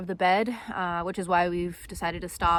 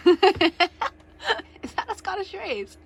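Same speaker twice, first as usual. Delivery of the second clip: laughing question, drawn out; 8 semitones higher, much slower than usual. Real, not acted. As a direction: ask it while cracking up. Laughing at partner.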